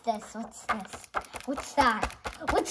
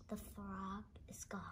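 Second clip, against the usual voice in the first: whisper; 4 semitones lower, 20 dB quieter than usual. Real, not acted. Whispering dismay as the frog leaves.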